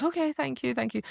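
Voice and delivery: high-pitched, mock-flustered thank you; hurried polite thanks. Flustered guest to innkeeper, high hurried thanks. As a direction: pipe it up high and over-polite.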